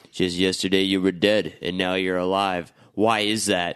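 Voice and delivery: low, measured questioning; acted suspicion. Villagers questioning the man back from death, low suspicion. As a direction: question him low and deliberately.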